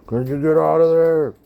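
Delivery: a man outside the stall, impatient; impatient demand. Retold or imitated. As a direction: shove the words through the door.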